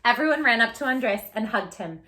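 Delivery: put-on reading a picture book aloud to kids; gentle storytime narration; storybook narration. A narrator's reading. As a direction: tell it like a read-aloud story for children.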